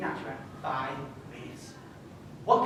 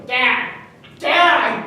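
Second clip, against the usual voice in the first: shouting; 3 semitones higher, 11 dB louder than usual. Acted. Son shouts at his father in rage. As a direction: cut him off with a shout.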